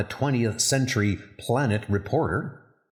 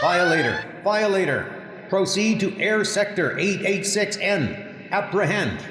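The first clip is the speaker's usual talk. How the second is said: higher, clipped dispatcher announcement; patrol alert call; 8 semitones higher than usual. Acted. Patrol dispatch calls ships to apprehend the violator, raised and commanding.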